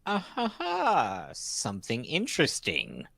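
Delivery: high, slow, sly drawn-out ah ha ha; intrigued. Acted. Delian overhears a secret and relishes it, high and slow. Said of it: "Delian's gonna be like".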